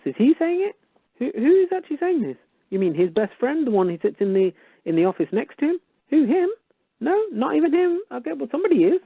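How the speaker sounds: mock incredulous high voice. Ranting at the tax office, high incredulous voice. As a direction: squeak it up in fake disbelief.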